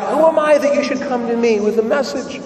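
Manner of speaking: high-pitched exclamation of wonder; retold cry. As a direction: cry out in amazement, without much added volume. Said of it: "Elizabeth cries out".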